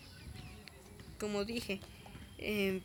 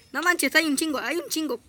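panicked; 6 semitones higher, 8 dB louder than usual. Real, not acted. Mobs attacking in game.

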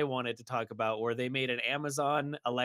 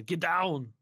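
Arnold Schwarzenegger; 3 semitones higher, 4 dB louder than usual. Retold or imitated. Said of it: "Arnold Schwarzenegger is gonna have to come back".

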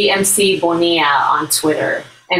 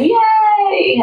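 excited cheer. Real, not acted. Cheering that the show returns.

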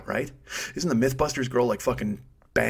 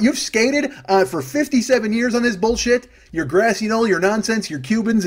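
mock angry shout; 5 semitones higher than usual. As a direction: yell at him like a tough cop. Shouting down Oswald in jest.

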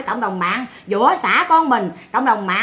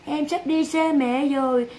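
mock tearful mother; 7 dB quieter than usual. Mother telling her son she is losing him, mock weepy. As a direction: imitate a mother's fake crying farewell, much softer. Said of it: "Lại nó mình nói thương con á".

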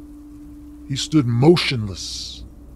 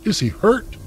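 high, anxious asking; mother begging the visitor for news; frightened question; 11 semitones higher than usual. Acted. Mother asks visitor if son is hurt, frightened and high.

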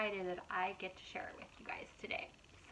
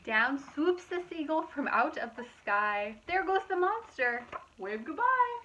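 lively, louder storybook narration; reading to children; 5 semitones higher, 8 dB louder than usual. A narrator's reading. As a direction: read it out brightly to a young audience. Reading picture book aloud.

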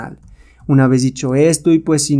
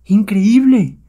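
high cry of astonishment; amazed cry; 7 semitones higher, 3 dB louder, much slower than usual. Acted. Onlooker watches the hero beat the apes, amazed. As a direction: marvel out loud, pitched up.

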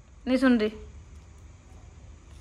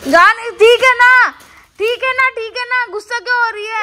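high, sweet pleading to calm her; 9 semitones higher, 8 dB louder than usual. Real, not acted. Partner soothing her sulking girlfriend in high coaxing tones. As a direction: coax her out of the sulk in a sing-song.

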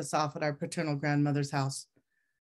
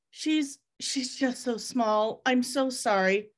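slow, higher, halting words on the verge of tears; choked-up brother; 8 semitones higher, slower than usual. Retold or imitated. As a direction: let it break apart, slowly, near crying. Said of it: "And then he began to cry".

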